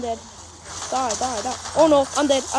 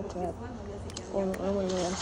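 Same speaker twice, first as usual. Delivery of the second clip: ordinary talk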